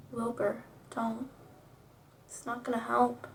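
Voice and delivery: very soft, halting voice; hushed, spent refusal; weak and resigned. A narrator's reading. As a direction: murmur it faintly, as if badly hurt. Wounded character refuses help.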